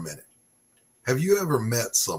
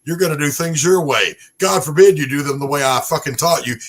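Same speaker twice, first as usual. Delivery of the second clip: louder, higher berating sergeant; drill sergeant; 5 semitones higher, 8 dB louder than usual. Retold or imitated. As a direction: chew out the recruit, sergeant style.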